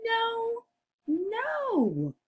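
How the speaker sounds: very high, squeaky little pig; high-pitched piggy voice. Acted. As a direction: squeal a refusal as a tiny pig. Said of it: "the two little pigs both say".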